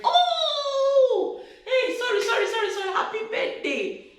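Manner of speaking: high, breezy offhand apology; careless husband. Retold or imitated. Husband brushing off wife's forgotten birthday, breezy high sorry. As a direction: toss off a casual sorry. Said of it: "Just like that".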